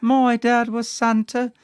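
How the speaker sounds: a high, slow British child's voice, imitating; a pretend British kid making a wish; British accent. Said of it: "why you have a British accent in this".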